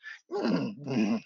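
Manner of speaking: imitated horse whinny. A narrator's reading. Game master shows what Mott and Brian hear, whinnying. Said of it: "all you hear is".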